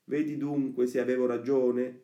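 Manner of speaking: put-on high, emphatic boy's voice; Lucignolo. The friend replying to Pinocchio that he was right, boastful. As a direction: crow it as the friend proved right. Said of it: "ripigliava quest'ultimo".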